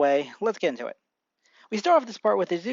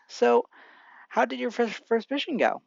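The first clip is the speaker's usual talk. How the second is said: lightly voiced mother, pitched up; curious mother; 6 semitones higher than usual. A narrator's reading. Mother asking her son about his first mission, high curious voice. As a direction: ask in a higher, interested voice.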